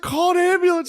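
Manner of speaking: hammed-up frantic call; mock urgent plea. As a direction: plead urgently up high. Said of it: "She was like".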